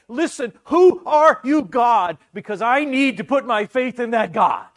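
loud, slow cry of "Listen, who are you"; loud plea to God. Retold or imitated. Preacher voicing the believer's cry, loud slow plea. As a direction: call out to God with everything. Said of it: "you say".

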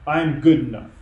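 proud king insisting he is good enough; insistent repeat. Retold or imitated. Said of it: "I can just hear him right now".

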